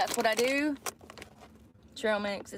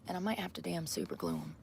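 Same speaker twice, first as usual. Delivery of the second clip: whispering; 4 semitones lower, 6 dB quieter, faster than usual. Real, not acted. Muttering to herself in car.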